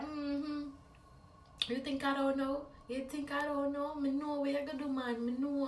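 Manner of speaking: knowing Jamaican uncle, lightly voiced. Uncle hinting he saw her sneak out, light Jamaican accent. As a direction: tease like an uncle who knows. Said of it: "He was like".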